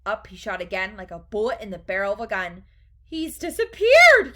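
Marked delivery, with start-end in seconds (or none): 3.0-4.3 high, very loud, drawn-out wail; yelled. A narrator's reading. Mother sees her son vanish up the pipe, yelled. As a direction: yell in horror. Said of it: "yelled Missus Gloop".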